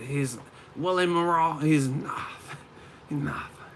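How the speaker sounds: drawn-out dismissal in imitated Kazakh accent; Golovkin. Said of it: "He said".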